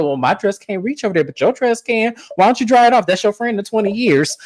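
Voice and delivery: high, sly put-on voice; high mock voice. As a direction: throw shade in a high voice. Voicing Wendy's shade.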